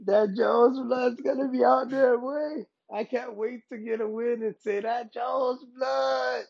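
high, excited hype, ending in a drawn-out cry; fired up. Real, not acted. Fighter who can't wait to get a win, fired up. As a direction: build to a long, high catchphrase cry. Fired up about his hero's return.

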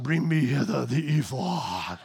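mock Darth Vader voice. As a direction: growl it like a space villain. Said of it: "like Darth Vader or something".

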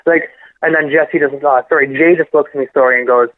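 ordinary delivery

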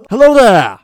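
high, booming cartoonish call; loud mock greeting. Player casting Minor Illusion to speak, loud hail. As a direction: holler it like a conjured voice.

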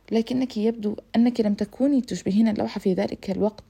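plain reading voice

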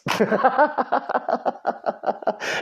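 breathy high-pitched giggle. Real, not acted. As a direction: giggle at your own joke. Laughing at his own jab.

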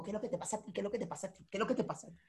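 low-volume, repetitive mock needling; muted challenge. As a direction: act out a quarrel very softly on a loop. Acted fight, repeated challenge under breath.

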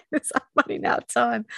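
laughing high through tears held back; a real emotional giggle into the line; nervous laugh. Real, not acted. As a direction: laugh it off, close to crying. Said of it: "I'll try not to cry".

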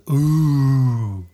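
a loud, drawn-out heckler's ooh; mock ooh. The guy at the next table jeers at the comic, a loud mock ooh. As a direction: let out a jeering ooh from the crowd. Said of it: "the guy next to me goes".

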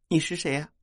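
略带委屈，音调偏高; 像刚哭过的女孩高声询问; 抹泪发问. A narrator's reading. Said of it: "一边抹着泪， 一边问". (含泪地问)

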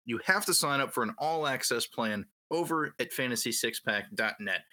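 usual speaking voice

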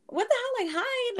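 high-pitched disbelief; retold incredulous protest. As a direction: protest an absurd suggestion, voice shooting up. Incredulous reply to being told hide.

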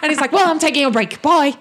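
high, loud, defiant; loud cheeky NPC; cheeky bickering. Acted. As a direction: brush off the boss.

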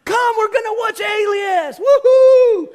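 high, very loud gleeful shout; dad inviting the toddler to watch, cheering loudly; excited cheer. Retold or imitated. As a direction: whoop like it's a party. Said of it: "I'm like".

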